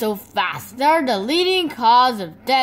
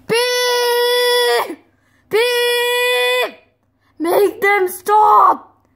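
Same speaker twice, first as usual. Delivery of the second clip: very high loud screech; shrill shouting; 12 semitones higher, 6 dB louder, much slower than usual. Acted. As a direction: scream over the noise. Chicks screeching, fox begging.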